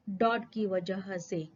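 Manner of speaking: ordinary talk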